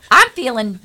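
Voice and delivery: ordinary talk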